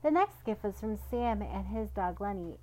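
ordinary delivery, nothing marked

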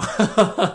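loud, high laugh; amused laugh. Real, not acted. Laughing at the friend's rice-farmer sausage drawing. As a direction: burst out laughing.

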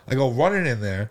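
ordinary talk

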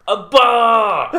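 loud laughing mock yell; mock shout. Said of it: "It's like".

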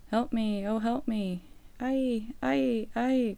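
high, wailing wolf's plea for help; crying out. A narrator's reading. Trapped wolf begs the maiden for help, crying out. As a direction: cry out in rising and falling wails. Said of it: "cried the wolf".

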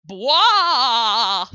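loud ghostly wail; spooky ghost voice. Acted. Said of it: "That sounded like a spooky ghost".

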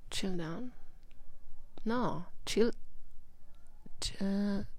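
very soft, half-whispered searching for the English phrase; muttering to herself. Real, not acted. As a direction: mumble it to yourself as if trying to remember the words.

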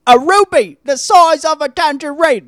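high, loud put-on old English voice; Michael Caine impression. Host quoting Michael Caine's line in put-on voice. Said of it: "That's all I want him to say".

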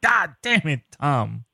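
mock exasperation.

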